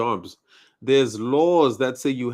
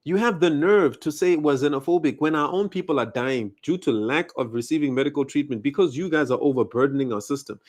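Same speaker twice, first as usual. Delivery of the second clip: normal talking voice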